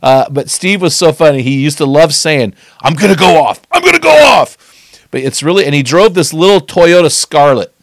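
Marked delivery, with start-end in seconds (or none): 2.8-3.6 mock threat, lightly raised; imitated teammate catchphrase. Preacher imitating his funny football buddy, mock threatening. 3.7-4.6 very high, loud comic yell; mock outburst. Preacher imitating his funny football buddy, high mock yell. Teammate's catchphrase repeated, escalating.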